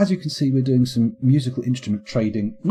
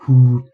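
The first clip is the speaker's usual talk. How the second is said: blowing whoo; 8 dB louder than usual. Shown as a demonstration. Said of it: "the blowhole that you blow across".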